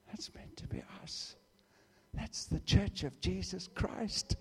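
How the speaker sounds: hushed whisper; breathy aside. Real, not acted. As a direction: lean in and whisper it.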